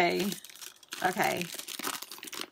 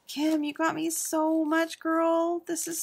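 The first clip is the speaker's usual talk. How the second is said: gushing thanks; 10 semitones higher, 5 dB louder, slower than usual. Real, not acted. Thanking friend for gifts.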